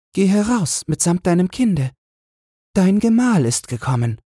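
high, light angelic voice; the angel answers the queen, high and light. A narrator's reading. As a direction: speak as a kindly angel from heaven.

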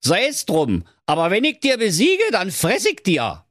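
high-pitched, threatening hare voice; cocky hare. Acted. Hare accepting the hedgehog's bet, cocky. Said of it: "Da lachte der Hase und sprach".

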